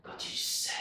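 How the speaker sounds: slow, low and ominous; dramatic. Acted. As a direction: draw it out darkly.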